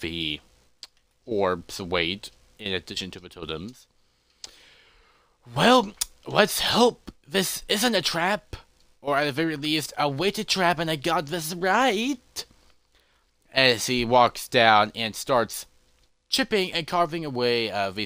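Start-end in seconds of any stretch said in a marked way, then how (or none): 5.6-12.4 raised voice, hoping against hope; nervous hoping. Acted. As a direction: pitch it up, stretching the ends. Character hopes aloud before risky attempt.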